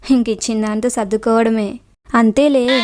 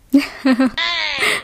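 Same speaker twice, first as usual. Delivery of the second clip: light laugh on a slightly raised pitch; risa; 3 semitones higher than usual. Acted. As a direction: laugh it off briefly. Risa tras burlarse de Anji.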